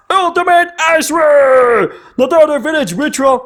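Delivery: hammed-up anime battle cry; attack name shout. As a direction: yell the attack like a villain unleashing it. Calls out attack card name.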